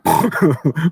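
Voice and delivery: short amused snort; boyish snort laugh. Acted. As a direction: scoff at the silly question. Boy snorts at vampires.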